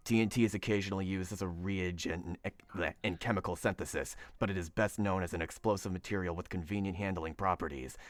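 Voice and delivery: very low, hushed superhero growl; deep, quiet mock-serious voice; Batman. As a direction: read the science text as a brooding dark vigilante. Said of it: "read this in my Batman voice".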